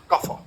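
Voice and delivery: "caza" with th and rounded, o-like vowels; zo variant. Shown as a demonstration. Lecturer showing the zo group's casa, rounded th. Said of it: "Los que eligieron la zo".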